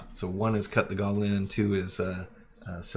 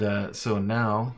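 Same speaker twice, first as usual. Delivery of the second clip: normal talking voice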